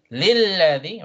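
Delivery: للذي leaning hard on the doubled L; emphasized shaddah. Shown as a demonstration. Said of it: "so emphasize".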